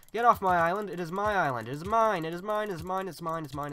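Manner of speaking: high, loud, insistent shouting; frantic possessive yell, easing as it repeats; territorial yelling. Real, not acted. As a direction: claim it loudly, over and over. Yelling at rival on his island.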